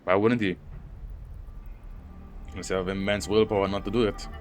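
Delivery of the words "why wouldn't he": normal talking voice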